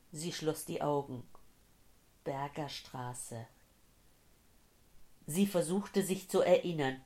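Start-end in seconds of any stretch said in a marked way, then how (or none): 2.2-3.5 soft, slow, faraway repetition; dazed echo. Acted. Dazed woman trying to remember a street, soft and slow. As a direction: turn the name over slowly and quietly.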